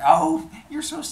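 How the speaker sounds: high, loud coquettish gush; giggly flirting. Acted. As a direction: play up a silly flirty admiration. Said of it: "they do the giggle flirty thing".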